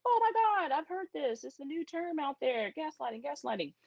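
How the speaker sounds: high, gushing imitation of everyone; mock excited gossip. Imitating people excited about a new buzzword, high and gushing. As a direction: ham up the breathless hype over a buzzword.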